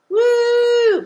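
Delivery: high, loud cheer; excited woo. Real, not acted. Host cheering about tonight's lotto ticket, high excited whoop. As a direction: whoop with glee over the ticket.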